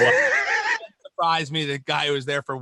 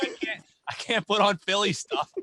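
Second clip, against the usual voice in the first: normal talking voice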